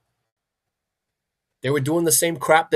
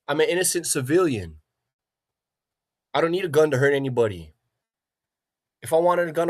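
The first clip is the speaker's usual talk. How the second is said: ordinary talk, nothing marked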